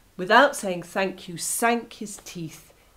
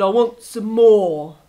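greedy wolf; 11 dB louder than usual. Acted. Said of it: "licked his lips and said".

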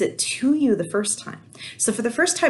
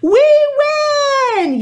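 cheering yell; 12 dB louder than usual. A narrator's reading. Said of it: "yelled Sierra's team".

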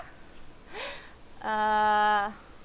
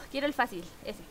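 ordinary talk, nothing marked

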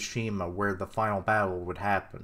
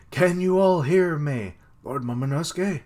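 loud, raised call to a crowd; 5 semitones higher, 5 dB louder than usual. Acted. Captured boy calls to everyone, calling out loudly. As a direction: call out as if to distant allies.

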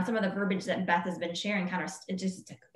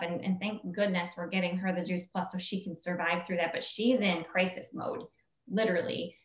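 ordinary talk, nothing marked

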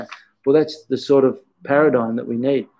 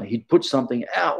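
normal talking voice

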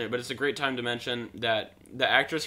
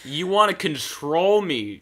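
loud, high, drawn-out accusation; hammed-up defiance; mock accusing star; 5 semitones higher, 7 dB louder, slower than usual. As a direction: stretch it out like a bad actress. Mimicking star confronting her father.

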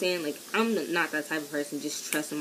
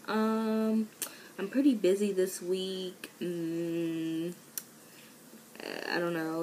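retold hedging with drawn-out hums; reluctant stalling; 2 semitones higher, slower than usual. Student retelling dodging his invitation to hang out, mildly reluctant. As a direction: stall between phrases, mildly evasive.

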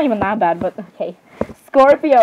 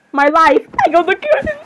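voice near cracking; 7 semitones higher than usual. Real, not acted. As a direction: blurt it high, voice about to crack. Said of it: "I feel my voice is gonna crack".

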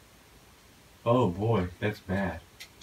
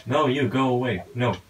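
louder, higher shout to shoo it off; panicked shout; 5 semitones higher, 6 dB louder than usual. Real, not acted. Gamer chased by a witch, panicked shout. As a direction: yell it away in a panic.

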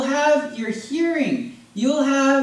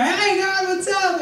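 much higher, breezy mock-casual hello; casual greeting to God; 9 semitones higher than usual. Preacher playing a smug churchgoer at the throne, breezy and high. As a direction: greet God like an old buddy.

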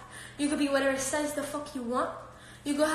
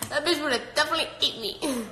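usual speaking voice

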